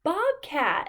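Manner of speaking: ordinary delivery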